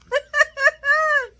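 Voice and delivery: high burst of real laughter. Real, not acted. Vlogger cracking up at the soap scene, loud laugh. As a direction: crack up at your own story. Laughing at her own recap.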